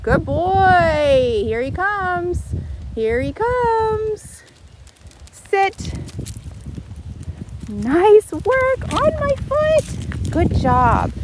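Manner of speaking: high, slow, loud praise; excited dog praise. Real, not acted. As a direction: gush over the dog. Praising dog coming back.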